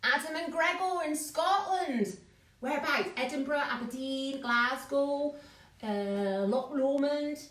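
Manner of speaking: playful, badly put-on Scottish accent. Host greeting viewers in Scotland, bad Scottish accent. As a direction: have a cheerful go at Scottish, badly. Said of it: "It's a very bad accent".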